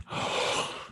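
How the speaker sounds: sharp breathless gasp; quiet winded gasp; pained gasp. Retold or imitated. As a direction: gasp like the wind is knocked out. Reenacting fall pain.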